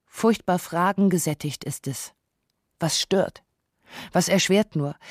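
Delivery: usual speaking voice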